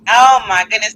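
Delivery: normal talking voice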